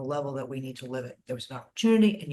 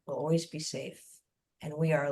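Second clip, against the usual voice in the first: usual speaking voice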